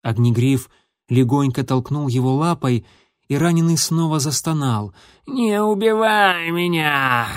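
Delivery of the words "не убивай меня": slow, high, frightened begging; wounded pleading. A narrator's reading. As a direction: plead weakly for your life. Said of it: "взмолился он".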